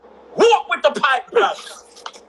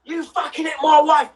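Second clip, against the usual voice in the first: husband's mock shout; 7 semitones higher than usual. The husband coming at nan, angry shout. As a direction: yell it like a husband charging in. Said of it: "The man's come like".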